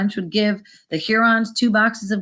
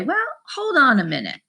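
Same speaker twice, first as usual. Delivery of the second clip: higher-pitched, lightly acted objection; stalling haggler; 7 semitones higher than usual. French trader answering the Hurons' offer, stalling. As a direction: hold off the deal, pitch rising.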